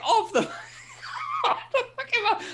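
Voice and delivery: high squeal breaking into laughter; incredulous laughing. Retold or imitated. Retelling being thrown off the map, laughing disbelief. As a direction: squeak it out while cracking up.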